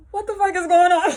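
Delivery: loud, high disbelieving cry; shocked outburst. Retold or imitated. She reacts to being confronted, loud shock. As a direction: blurt out in shock.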